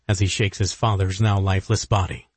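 usual speaking voice

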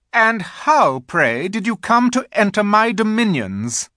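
high, loud, haughty demand; imperious queen. A narrator's reading. The Queen questions the intruder, imperious. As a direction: ring it out like an affronted monarch.

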